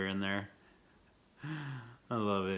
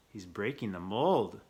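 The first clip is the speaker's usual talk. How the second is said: usual speaking voice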